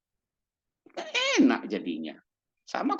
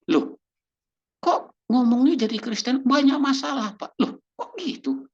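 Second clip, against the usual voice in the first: indignant surprise; 10 semitones higher, 4 dB louder than usual. Retold or imitated. Believer protesting to critic.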